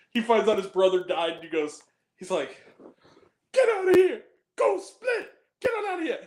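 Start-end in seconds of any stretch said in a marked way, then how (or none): 3.5-6.2 high-pitched movie-hero impression; imitating an overwrought film line; mock dramatic dismissal. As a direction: send them away in hammed-up high cries. Retold reaction to brother's death.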